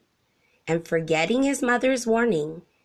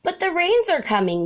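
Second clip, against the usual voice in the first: meerkat warning; 10 semitones higher, 4 dB louder, faster than usual. A narrator's reading. Meerkats warn Monty.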